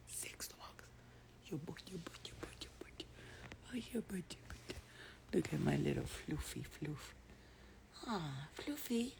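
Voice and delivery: soft whispered coo to the dog. Real, not acted. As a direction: croon sweetly to your pet. Cooing to dog quietly.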